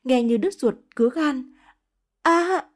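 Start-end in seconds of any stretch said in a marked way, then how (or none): 2.2-2.6 sharp high scream of pain; long scream. A narrator's reading. Pregnant woman slamming into the sink screams. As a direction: cry out in agony as she hits the sink. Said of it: "sau tiếng thét thật dài".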